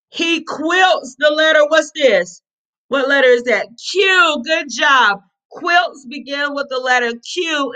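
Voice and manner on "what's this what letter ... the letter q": usual speaking voice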